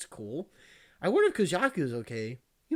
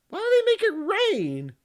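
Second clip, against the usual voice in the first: much higher, slow squeaky complaint; mock whiny question; 6 dB louder than usual. Gamer mocking the weather program, high whiny voice. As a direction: whine about the rain in a silly high voice.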